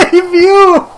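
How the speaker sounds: squealing laugh mid-sentence; can't get the words out for laughing; high helpless laughter. Real, not acted. Bursting into laughter at twist.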